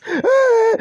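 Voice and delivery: sudden high loud yelp; a short, very high, loud cry of pain. Acted. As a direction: yelp as the dog bites. Bitten in the buttocks.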